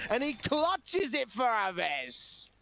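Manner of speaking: rising excited play-by-play; esports caster. Acted. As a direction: call the clutch as it happens. Live play-by-play climax.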